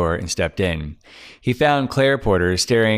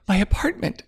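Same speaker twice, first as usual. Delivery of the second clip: sniffling; 12 semitones higher than usual. Acted. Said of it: "she sniffed".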